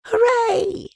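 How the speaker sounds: very high, loud cheer; child's shout. A narrator's reading. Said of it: "you shouted".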